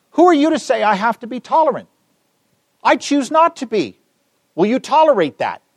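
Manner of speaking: high-pitched mock indignation; indignant challenge. Preacher voices a relativist refusing tolerance, high indignant challenge. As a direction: push it up high, challenging the listener.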